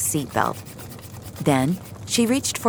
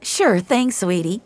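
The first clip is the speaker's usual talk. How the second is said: high, bright diner waitress; waitress checking on the table; cheery waitress; 5 semitones higher than usual. Acted. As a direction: chirp like a friendly waitress. Waitress answering the child.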